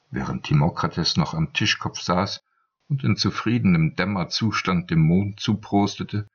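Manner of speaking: ordinary delivery, nothing marked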